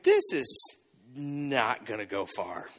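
low, slow, deflated muttering; a disciple sinking at the size of the crowd; doubtful aside. Retold or imitated. Disciple eyeing the huge crowd, low and slow in doubt. Disciple facing five thousand people.

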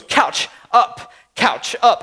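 put-on isolated baby-talk words; short toddler commands, spaced apart; imitating toddler. Toddler pointing at the couch, baby-talk demand. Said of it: "and he goes".

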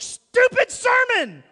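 high, loud, slow shout of "stupid sermon"; hammed-up angry outburst at the sermon; frustrated shout. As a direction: yell it in frustration. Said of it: "I said that out loud".

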